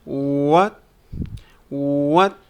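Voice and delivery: "What" gliding up into a question; rising intonation. Shown as a demonstration. Teacher modelling a short question, rising "What". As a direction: lift the pitch through "What". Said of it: "The intonation goes up".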